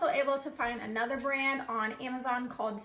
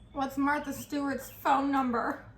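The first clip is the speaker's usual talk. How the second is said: normal talking voice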